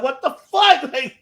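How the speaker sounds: high incredulous what the fuck; reliving the shock, voice jumping up; stunned disbelief. Retold or imitated. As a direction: fan recalling a betrayal, high stunned disbelief. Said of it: "I was like".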